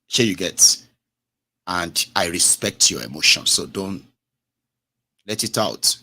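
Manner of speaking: normal talking voice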